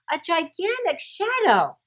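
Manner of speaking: ordinary delivery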